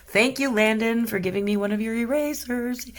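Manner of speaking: high, singsong thank-you; playful mock thanks. Painter thanking absent Landon for his eraser, playful mock thanks.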